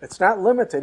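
preacher hammering the point; emphatic. Real, not acted. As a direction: drive the point home.

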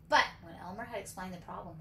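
ordinary delivery

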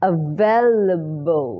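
"available" very slowly with weak schwa syllables; schwa pronunciation. Shown as a demonstration. Teacher repeating the schwa word, slow drawn-out pronunciation. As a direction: draw out "available", long in the middle, schwa around it. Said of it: "ชวา ชวาด้วย".

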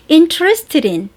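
Interested in said slowly, every syllable clear; slow pronunciation demo. Shown as a demonstration. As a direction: draw out "Interested in" for learners to copy. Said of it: "연습 한번 먼저 두 번 해보시겠습니다".

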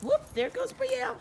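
high squeaky exclaim; excited. Real, not acted. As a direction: pitch up high in delight. Watching dog run off.

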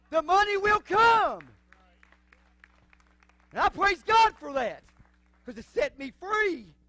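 swelling from strong to a loud shout; building preaching. Acted.

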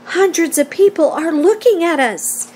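ordinary delivery

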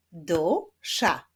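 "Dusza" as two separated syllables; split into syllables. Shown as a demonstration. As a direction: sound out "Dusza" beat by beat. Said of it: "wyklaszczmy sylaby w wyrazie".

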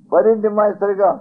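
loud, high, slow call to the priest; a boy crying out for the old priest; child calling. Retold or imitated.

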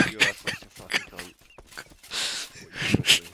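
ordinary talk

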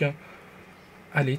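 usual speaking voice

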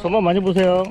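loud friendly goodbye call. Real, not acted. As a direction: shout the good-luck wish across. Calling parting wish.

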